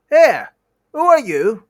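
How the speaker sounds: very high, loud squeak; a tiny shrill purse squealing in alarm. Acted. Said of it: "it squeaked".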